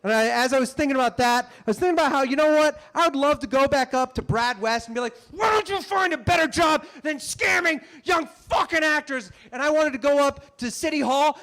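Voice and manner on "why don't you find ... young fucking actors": angry tell-off. Pretend. Actor imagines scolding the scammer, angry yell. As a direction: tear into the scammer. Imagined telling off Brad West.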